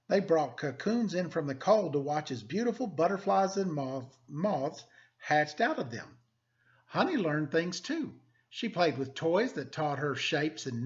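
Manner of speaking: lively, expressive picture-book reading; storybook narration for students; reading to children. Acted. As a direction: read it like story time for the class. Teacher reads picture book aloud.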